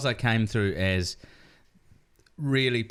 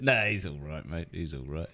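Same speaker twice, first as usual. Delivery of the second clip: grudging praise fading out, much softer; backhanded praise; 5 semitones lower, 4 dB quieter than usual. Retold or imitated. Kiwi bloke grudgingly praising Paul Ego, fading. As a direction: mutter it like a reluctant compliment. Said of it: "classic sort of backhanded".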